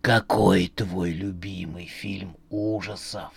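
low, slow robotic voice; slow, low, machine-like question; metallic voice. Acted. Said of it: "вновь прозвучал металлический голос".

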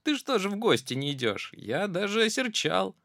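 high, fast mock reproach; teasing mouse. Mouse scolding the fox in fun, high. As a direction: pretend to be offended.

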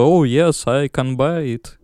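mock American accent in English; playing a hammy American buyer. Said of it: "это так американцы разговаривают?".

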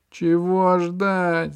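high, loud, slow squeal. A narrator's reading. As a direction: shriek it in panic. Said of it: "завизжал мяч".

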